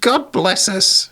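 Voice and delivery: put-on very high, slow squeaky child-ghost voice; cute little spirit. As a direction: squeak it out slowly as a tiny spirit. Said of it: "a cute little spirit saying".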